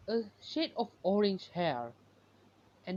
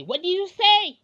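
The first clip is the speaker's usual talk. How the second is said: angry shout, higher and louder; 10 semitones higher, 9 dB louder than usual. Acted. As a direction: bark it back at them, furious. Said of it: "they getting mad and say".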